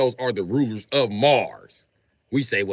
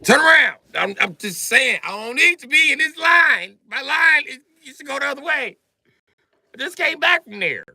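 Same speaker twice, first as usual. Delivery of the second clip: high, loud bossy orders; bossy gatekeeper; 13 semitones higher, 5 dB louder than usual. Acted. Heavenly gatekeeper turning souls around, high loud bossy. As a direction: order him around like a bouncer. Angel gatekeeper voiced shouting.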